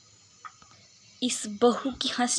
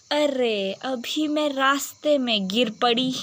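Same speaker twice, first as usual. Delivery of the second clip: usual speaking voice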